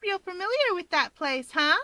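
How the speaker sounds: high, playful ribbing; a high teasing poke at him. Acted. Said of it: "Instead, she teases".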